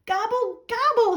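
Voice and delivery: high, rising howl of gobble. Acted. Turkey running from class, howling his gobble: high wail. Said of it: "he howled".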